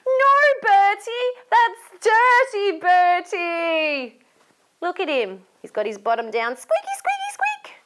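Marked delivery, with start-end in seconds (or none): 0.1-4.2 high, loud, drawn-out telling-off; scolding. Acted. Storyteller leading children to tell Bertie off, scolding. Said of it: "We better tell him". 6.6-7.7 tiny, very high squeaky voice; high squeak. Acted. Storyteller pointing at Bertie's bare bottom, squeaking.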